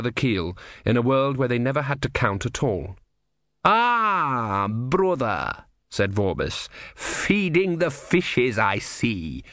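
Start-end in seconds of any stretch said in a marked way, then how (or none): 3.6-5.6 menacing inquisitor. Acted. Vorbis greets brother, slow and cold. 6.9-9.4 menacing inquisitor. Acted. Vorbis mocking remark.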